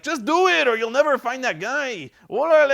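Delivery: high, loud mock Mexican accent for the warlord. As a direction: ham up a Mexican bandit boss giving orders. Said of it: "He's like".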